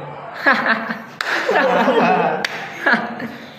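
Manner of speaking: high, loud burst of jeering laughter; loud mocking laughter. Classmates mock the new pupil with loud laughter.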